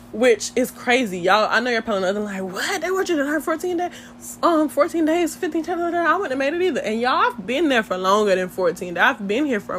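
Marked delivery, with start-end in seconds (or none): 2.4-6.8 high-pitched imitation of shocked listeners; mock disbelief. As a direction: mimic an incredulous viewer, voice pitched up. Voices shocked viewers reacting.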